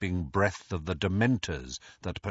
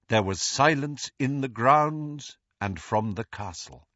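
plain reading voice

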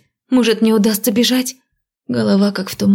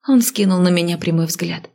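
usual speaking voice

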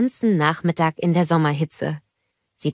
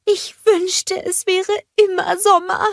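longing to hug the sun; dreamy Olaf; 14 semitones higher than usual. A narrator's reading. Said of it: "seufzte vor Glück".

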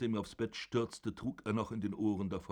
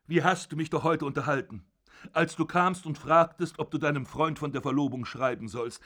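put-on loud, raised furious old father berating his son; zorniger alter Vater; 4 semitones higher, 6 dB louder than usual. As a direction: bellow down at your son from the bed. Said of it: "father mocks Georg". (wütender Vater, laut und erhoben)

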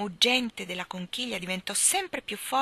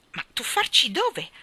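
slow, high, louder disbelief; incredulous; 7 semitones higher, 7 dB louder than usual. A narrator's reading. Michael questions the strange voice's advice, incredulous. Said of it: "disse Michael incredulo".

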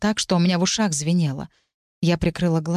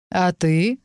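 level, pointed retort; even but sharp. A narrator's reading. Son turns the accusation back on the mage, even but sharp. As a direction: keep it steady and cutting. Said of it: "оставался ровным, но настолько резким".

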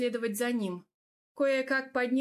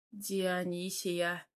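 slow, lower, tender utterance of a name; smiling, savouring a name; 3 semitones lower, 4 dB quieter, much slower than usual. A narrator's reading. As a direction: say the name slowly through a fond smile. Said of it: "проговорил я, вновь улыбаясь".

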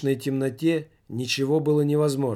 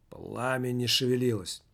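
plain reading voice

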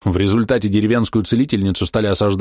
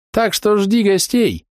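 slow theatrical villain menace; 12 semitones higher, slower than usual. A narrator's reading. Threat in villain manner.

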